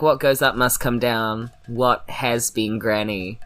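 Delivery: usual speaking voice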